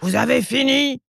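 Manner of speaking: high, stretched roar without volume; ogress roar. A narrator's reading. Ogress checking on captive elves, contained roar. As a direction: roar the question, pitch climbing. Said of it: "l'ogresse ouvre la porte et rugit".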